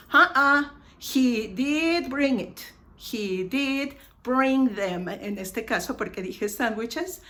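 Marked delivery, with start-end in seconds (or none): 0.1-5.1 loud "Uh-uh", then slow weight on DID; contradict, stretching DID; emphatic contradiction. Acted. Somebody objects that he brought sandwiches, contradicting with stressed DID. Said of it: "and somebody says".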